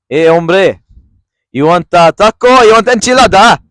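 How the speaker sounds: loud hammed-up Mexican accent; mock Mexican accent. A podcaster tries a racial impersonation, loud hammed-up Mexican accent. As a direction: sell enchiladas in a cartoon Mexican accent. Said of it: "let me try Mexican".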